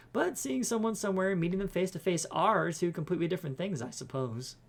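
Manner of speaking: bubbly housekeeper voice; cheery eager cleaner. Acted. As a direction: introduce yourself brightly and eagerly, pitch lifted.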